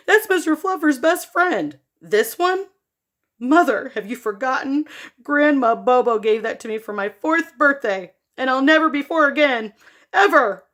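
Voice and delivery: high, loud melodramatic plea; dramatic. Acted. Boy pleading with mom over toy, hammed-up drama. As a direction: overplay the heartbreak. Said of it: "Don't be so dramatic".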